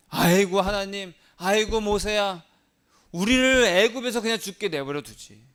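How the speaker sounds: loud, high lament; complaining wail. Acted. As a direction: moan and grumble to Moses. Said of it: "막 원망하고 불평을 합니다".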